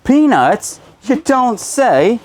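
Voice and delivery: high, loud mock English accent; drawn-out, exclaiming bad English accent. Reseller showing off an English accent while unboxing, mock English accent. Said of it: "was my English accent".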